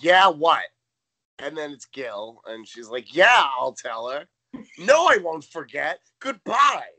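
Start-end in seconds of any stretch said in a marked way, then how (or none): 0.0-0.6 loud, curt mock phone greeting; rude phone answer. Tootie answering the phone, imitated rudely and loud. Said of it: "answers the phone so rudely". 3.1-6.9 loud, drawn-out mock exasperation; rude phone manner. Tootie taking a message from Gil, rudely and loudly. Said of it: "answers the phone so rudely".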